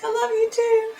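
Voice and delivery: high-pitched retold reply; imitating the singer. Singer answers the screaming fan, imitated in a high voice. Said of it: "She's like".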